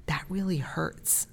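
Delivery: soft, slow, hurt tone; a gentle, wounded "that really hurts"; quiet hurt parent. Shown as a demonstration. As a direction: say it softly so the child feels the hurt. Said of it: "start teaching empathy".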